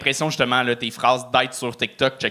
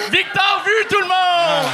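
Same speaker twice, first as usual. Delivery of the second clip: higher, drawn-out shout of the name; host announcing; slower than usual. Real, not acted. Host calls the comedian's name out to the crowd, shouting high. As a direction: call him up to the crowd, much higher.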